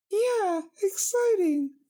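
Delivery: mock excitement in a squeaky voice; sarcastic. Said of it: "two polar opposites there".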